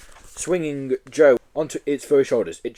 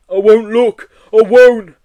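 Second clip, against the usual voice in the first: loud, slow, high blubber; 10 semitones higher, 13 dB louder, slower than usual. Acted. Yeti hiding behind its paws, blubbering. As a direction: blub it like a terrified beast.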